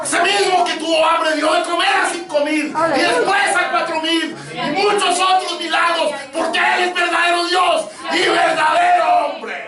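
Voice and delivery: high, loud sustained pulpit shout; roaring revival-preacher crescendo; preaching at full force. Real, not acted. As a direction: thunder it out, stretching the climactic commands. Climax of the sermon.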